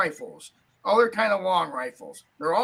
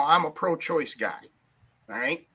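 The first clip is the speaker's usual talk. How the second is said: usual speaking voice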